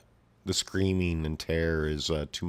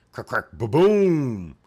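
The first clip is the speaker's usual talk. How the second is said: loud, slow, high voiced boom; explosion effect; 7 semitones higher, 11 dB louder, slower than usual. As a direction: belt out the blast.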